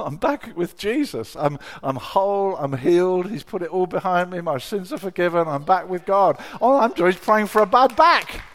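loud, elated cry of being restored; exultant outburst. Retold or imitated. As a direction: let the relief pour out, climbing in volume. Joy of healing, building to shout.

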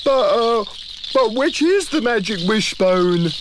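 high, halting creature voice; confused and stuck; flustered Moog. Acted. The Moog unsure which bone is magic, high and halting.

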